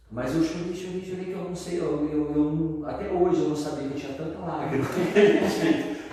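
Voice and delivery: high, insistent, laughing at the end; amazed emphasis. Real, not acted. Amazed at how much he cried.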